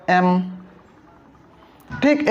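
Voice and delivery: normal talking voice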